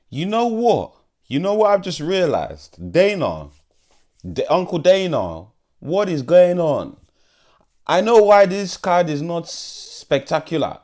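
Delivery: put-on high, loud, slowed African accent. Fight vlogger ranting about Dana, in African accent. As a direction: burst out in a heavy African accent. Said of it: "the African accent just naturally comes out".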